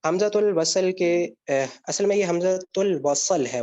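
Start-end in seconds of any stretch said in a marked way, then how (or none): none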